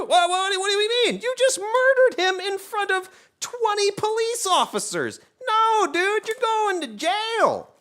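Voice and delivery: hammed-up officer scolding the killer; mock cop arrest. Imagined cop arresting Doom.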